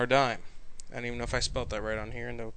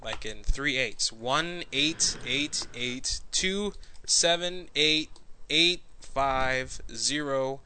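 slow, number by number; slow and clear; much slower than usual. Shown as a demonstration. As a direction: dictate it slowly. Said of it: "ready to write it down".

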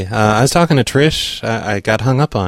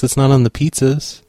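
ordinary talk, nothing marked

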